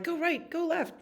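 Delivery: high, squeaky commands; herding dog. Acted. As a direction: give orders like a little herding dog.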